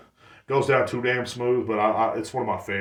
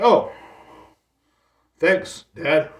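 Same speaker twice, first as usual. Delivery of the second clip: sarcastic thanks; 7 semitones higher, 6 dB louder, much slower than usual. Retold or imitated. Sarcastic reply to stepdad.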